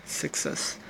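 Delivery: slow, careful SUCCESS with a short first vowel, "sik-SESS"; British-style SUCCESS. Shown as a demonstration. Coach showing how British people say it, slow SUCCESS as "sik-SESS". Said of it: "even in a British accent".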